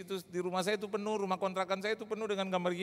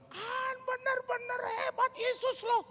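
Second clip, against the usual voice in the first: much higher, slow and wondering; awed praise; slower than usual. Retold or imitated. Healed friend praising Jesus to the pastor in awe. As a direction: gush in awe over Jesus.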